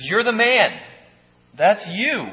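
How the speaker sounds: loud, high accusation; accusing Nathan. Acted. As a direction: point straight at him and nail it. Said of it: "He says".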